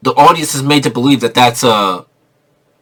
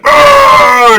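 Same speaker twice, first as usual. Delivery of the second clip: loud high mock monster roar; hammed-up zombie growl; 7 dB louder than usual. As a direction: roar like a faceless ghoul. Imitating the monsters.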